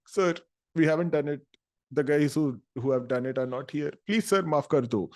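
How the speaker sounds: hammed-up begging; mock pleading. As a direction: imitate a student begging for forgiveness. Student pleading with gunman, acted.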